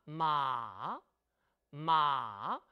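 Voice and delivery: "마" long, sinking low; third tone demo. Shown as a demonstration. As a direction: draw "마" down and let it drag low. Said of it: "삼 성은 쭉 길게 내려옵니다".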